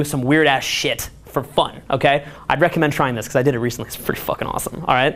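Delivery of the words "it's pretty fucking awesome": breathy whisper, much softer; whispered aside. Real, not acted. Speaker confiding to the audience, hushed whisper. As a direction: drop to a secret whisper. Aside while recommending the trip.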